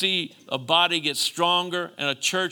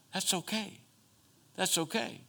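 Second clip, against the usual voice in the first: hushed, higher, tender preacher's aside; gentle reassurance; 4 semitones higher, 8 dB quieter than usual. Real, not acted. Preacher reassuring congregation about crying, gently. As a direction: softly let them know it is allowed.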